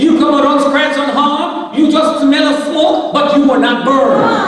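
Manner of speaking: fervent preaching. Real, not acted. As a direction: preach it to the congregation with full conviction.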